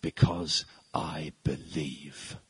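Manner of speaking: slow, low, cold villain line; a cold fanatic stating his creed; Gary Oldman's villain. Acted. Preacher voicing the terrorist answering the president, slow and cold. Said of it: "And Gary Oldman says".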